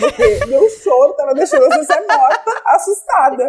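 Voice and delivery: ordinary delivery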